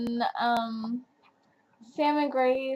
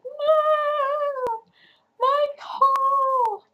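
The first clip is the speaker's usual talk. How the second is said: much higher slow far-off wail; anguished cry; 4 dB louder, much slower than usual. Retold or imitated. Reader reliving her reaction to Cole's death, slow anguished wail. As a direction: wail it as if he were lost. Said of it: "I was like".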